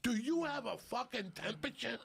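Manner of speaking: high-pitched imitation of a scolding mom; exasperated mother. Mother checking her wet-faced son, mock exasperation. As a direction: demand it like a fed-up mother. Angry mother questions sick child.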